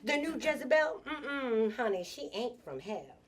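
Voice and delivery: high, loud spoken-word flourish; sassy poem declamation. Acted. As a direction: proclaim it with sass, drawing out the ending.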